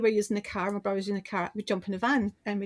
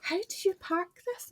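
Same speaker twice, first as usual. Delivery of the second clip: mock confused colleague; 9 semitones higher than usual. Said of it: "he's going".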